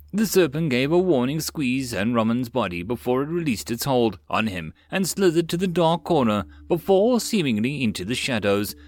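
ordinary delivery, nothing marked

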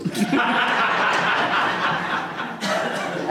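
airy laugh bursting out; high breathless laugh. Real, not acted. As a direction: let a high silent laugh escape. Laughs at the memory.

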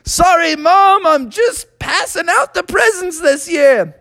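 put-on high-pitched earnest kid voice; Froggy. Child explains passing out presents, high earnest voice. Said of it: "And Froggy said".

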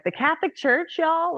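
high, squeaky 'are you serious' disbelief; mock-shocked yelp at the idea; incredulous disbelief. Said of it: "And I was like".

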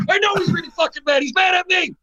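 high loud retold shout; high, loud, half-laughing alarm; mock panicked shout. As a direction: shout it like you're freaking out. Retold own panicked reply.

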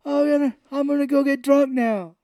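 high, loud put-on voice; mock worn-out performer. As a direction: act out the star announcing his escape. Acting out performer after show.

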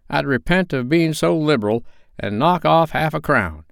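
put-on sly old pawnbroker welcoming them in; old Joe's voice. A narrator's reading.

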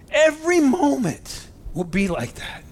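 excited and moved, near tears; choked up. Real, not acted. Preacher to his congregation, choked up with excitement. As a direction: voice rising high, choked with emotion. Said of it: "Sorry, I'm getting excited, getting choked up".

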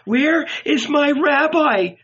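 high, slow, pleading wail; heartrending cry. A narrator's reading. Magdalene searching for her rabbi, heartrending. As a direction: draw it out in anguish. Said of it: "in a heartrending voice".

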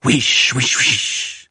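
imitated rush of incoming mortar shells; whooshed out as airy sound effects; whooshing shells. A narrator's reading. Narrator imitating deadly incoming shells, whooshing. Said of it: "we heard that inevitable and deadly".

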